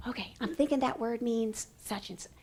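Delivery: very soft, private thinking aloud; whispered thinking voice. Shown as a demonstration. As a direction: murmur your thoughts as an aside. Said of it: "I lean over here and I whisper".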